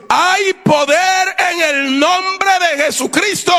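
high, slow, ringing proclamation; re-enacted command of faith; powerful voice. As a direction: proclaim it with power, stretching the words out. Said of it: "salió una voz poderosa".

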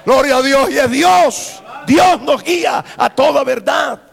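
loud, high, exhorting sermon; fervent preacher in full cry; full-force preaching. Real, not acted. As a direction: proclaim it to the whole church at high volume.

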